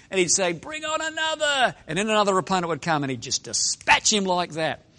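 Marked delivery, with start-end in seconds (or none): 0.5-1.7 high-pitched arena call; showy challenge. Acted. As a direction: call out for the next fighter, stretching the end. Acting Commodus challenging the next opponent.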